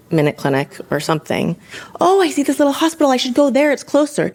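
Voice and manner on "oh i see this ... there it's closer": high, fast mock husband voice; imitating husband. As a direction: chirp it like the clueless husband. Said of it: "he must have seen a sign".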